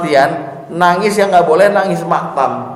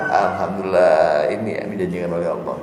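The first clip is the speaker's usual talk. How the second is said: grateful murmur; 10 semitones lower, 6 dB quieter than usual. Retold or imitated. Voiced joy at paradise verse.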